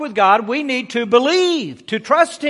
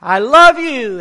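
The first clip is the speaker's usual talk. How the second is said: raised voice, higher and louder; loud call; 4 semitones higher, 7 dB louder, slower than usual. Acted. Boy calls to the valley as mother told him, loud call. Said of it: "real loud, tell that mean little boy".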